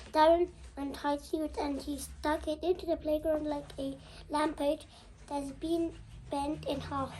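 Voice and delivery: ordinary delivery, nothing marked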